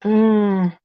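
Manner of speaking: ordinary delivery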